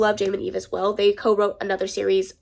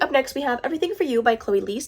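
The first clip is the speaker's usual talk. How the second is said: ordinary talk, nothing marked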